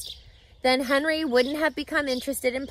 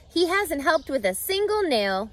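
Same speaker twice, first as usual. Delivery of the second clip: ordinary delivery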